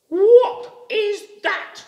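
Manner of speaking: high, slow, alarmed rabbit voice; high frightened rabbit; startled rabbit. A narrator's reading. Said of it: "said the rabbit".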